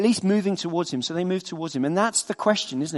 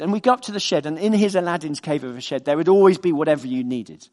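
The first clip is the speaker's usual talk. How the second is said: usual speaking voice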